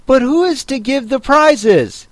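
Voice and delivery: high-pitched eager question from a crowd; chorus asking. A narrator's reading. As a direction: ask as one of a clamoring group. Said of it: "quite a chorus of voices asked".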